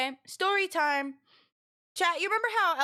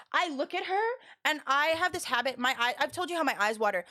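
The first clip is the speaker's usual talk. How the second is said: normal talking voice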